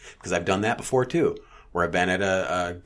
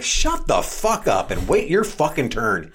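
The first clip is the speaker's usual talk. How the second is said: louder, slow retort; mock snap; 6 semitones higher, 6 dB louder, slower than usual. Telling the deli meddler off, louder and slower. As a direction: put the meddler in her place.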